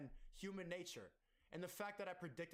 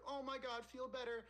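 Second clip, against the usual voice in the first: mock gushing commenter; 7 semitones higher than usual. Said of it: "be like".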